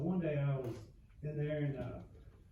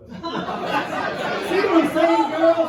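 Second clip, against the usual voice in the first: higher, louder, drawn out; loud laughing callout; 11 semitones higher, 10 dB louder, much slower than usual. Real, not acted. Calling out to women in crowd.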